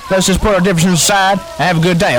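ordinary talk

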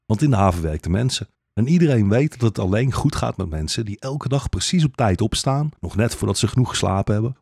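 plain reading voice

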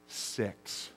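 breathless whispered count of six; hushed, stunned count; aghast whisper. Retold or imitated. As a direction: whisper it as your stomach drops. Head count comes up one short.

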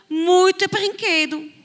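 high, loud, slow marvel; storyteller marvelling at a room full of toys; amazed. Acted. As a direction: make the kids wonder at all the toys.

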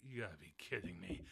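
low, soft disbelieving grumble; low gruff Logan voice. Retold or imitated. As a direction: scoff it like a jaded loner. Voicing Logan again.

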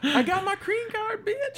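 high, slow sassy quip; sassy bride voice. Pretend. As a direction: play it as a smug bride. Mail order bride quip.

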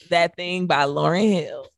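ordinary delivery

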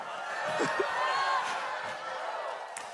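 a high, airy wheeze of a laugh; high breathy laugh. Real, not acted. Pastor laughing to the congregation, high and airy. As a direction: let out a silent wheezing laugh. Laughs at his own point.

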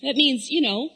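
ordinary talk, nothing marked

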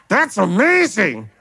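strained, put-on delight for someone else's blessing; forced congratulations. Envious believer congratulating someone else, forced and strained. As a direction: squeeze out congratulations you don't feel. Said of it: "I'm trying so hard to congratulate".

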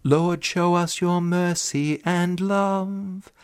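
slow, high chanted verse; cathedral echo. Acted. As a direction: chant it under church reverb. Sung gospel verse.

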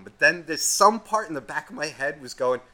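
ordinary talk, nothing marked

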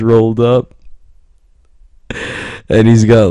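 words squeezed out high through laughter; can barely get it out for laughing; giggling helplessly mid-sentence. Real, not acted. Cracking up at his own story.

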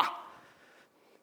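usual speaking voice

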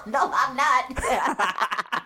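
giggling denial; laughing. Real, not acted. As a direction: laugh it off, embarrassed. Laughs at teasing.

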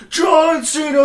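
high shouted wrestling crowd cheer; hyped crowd shout. Retold or imitated. Telling his friend about the entrance, a high shouted fan cheer. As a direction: yell the name like a fan in the stands.

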